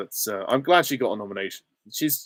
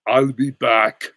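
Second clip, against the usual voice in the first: put-on slow, loud Austrian-accented action hero; heavy Austrian movie-star voice; Arnold Schwarzenegger; 2 semitones higher, 7 dB louder, much slower than usual. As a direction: growl it out as a killer robot. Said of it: "Arnold Schwarzenegger".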